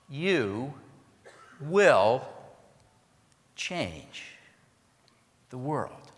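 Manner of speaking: spaced out, each word alone; slow dramatic close. Shown as a demonstration.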